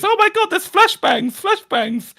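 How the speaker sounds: high, loud panicked shriek; mock terrified screech about flashbangs; panicked scream. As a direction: shriek it like she's lost her head. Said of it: "She's screaming like a lunatic".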